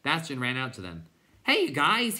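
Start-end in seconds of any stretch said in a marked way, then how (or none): 1.4-2.2 high, loud call to get attention; shout. A narrator's reading. Boy shouting up at rowdy creatures, high shout. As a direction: shout up at them, pitch jumping. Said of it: "he shouted".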